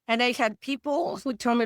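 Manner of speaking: usual speaking voice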